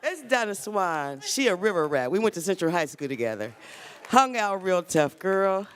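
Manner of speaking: loud, high, excited calling out; excited shout. Real, not acted. Greeting old school friend loudly.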